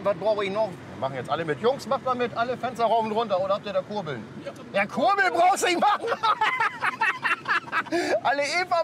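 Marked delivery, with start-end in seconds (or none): 4.4-8.8 laughing. Real, not acted. Teasing crew about window cranks.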